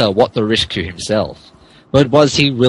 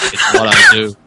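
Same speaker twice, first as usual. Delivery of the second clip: mock heroic squeak; 4 dB louder, faster than usual. Hero's mock-heroic reply.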